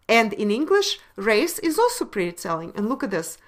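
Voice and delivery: ordinary talk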